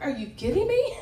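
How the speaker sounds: patient balking at the therapist's order, incredulous; mock disbelief. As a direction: play it like you can't believe what he asked. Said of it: "looking at him like".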